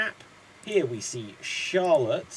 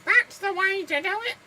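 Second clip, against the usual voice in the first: high, loud squawking puppet; Mr Punch voice; 4 dB louder than usual. Retold or imitated. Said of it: "Mister Punch's very distinct voice".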